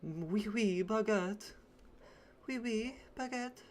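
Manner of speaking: loud hammed-up French accent, showing it off; cartoon French accent trying to find the character's voice; mock French accent.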